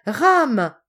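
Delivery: a high, loud cry of recognition; joyful cry. Acted. Pélagie cries out his name on seeing the sailor, loud and high. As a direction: call out his name in happy surprise. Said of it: "s'écria-t-elle".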